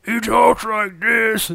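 slow, high put-on monster talk; Hulk. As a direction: imitate the character in slow, drawn-out words. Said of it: "He does an accent when he's the Hulk".

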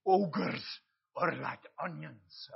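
put-on slow, drawn-out Shrek accent. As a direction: do your best Shrek accent, taking it slowly. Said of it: "That's my best Shrek accent".

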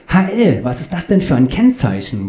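high, lively curious question; nosy passenger. Acted. Claudius asks about the number plate, high and curious. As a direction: pipe up with a puzzled high question.

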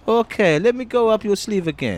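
high squeaky little creature; high vermin voice. Acted. Vermin character.

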